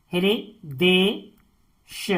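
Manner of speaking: "Hridesh" very slowly as हृ, दे, श with gaps; "Hridesh" spaced out for dictation; syllable by syllable. Shown as a demonstration. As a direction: break "Hridesh" into three separate pieces. Teacher splits word into syllables.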